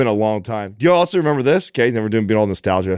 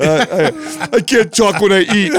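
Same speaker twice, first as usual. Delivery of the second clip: higher, louder whiny kid imitation; whiny toddler; 7 semitones higher, 4 dB louder than usual. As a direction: mimic the sulky kid's protest once more. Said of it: "he's, like, crying about it".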